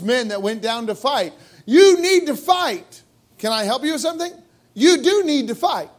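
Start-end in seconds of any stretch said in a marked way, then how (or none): 1.6-3.0 preaching shout. Acted. Preacher driving point home. 4.7-5.9 emphatic call. Acted. Preacher insists with emphasis.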